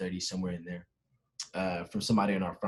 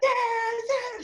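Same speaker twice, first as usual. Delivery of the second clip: very high imitated celebration scream; squealed reenactment of cheering; mock scream; 4 dB louder than usual. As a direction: imitate friends screaming into a mic. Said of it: "screaming on the microphone".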